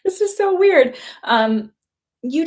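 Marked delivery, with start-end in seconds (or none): 0.0-1.0 high, tearful voice breaking into an embarrassed laugh; real crying. Real, not acted. Vlogger apologising to viewers for her tears, crying. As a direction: squeeze the words out through tears. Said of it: "I don't know why I'm crying".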